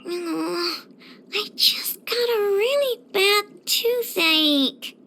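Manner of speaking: slow, pained wail; toothache whine. Acted. Game character moaning over a toothache, pained whine. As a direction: moan it out, drawing the words long.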